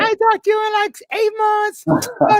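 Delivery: put-on very high, slow, syrupy girl voice; girl he hasn't heard from calling with a high sugary hello; mock sweet-talking girl. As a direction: coo it like an old flame after a favor. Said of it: "the girl's gonna come on".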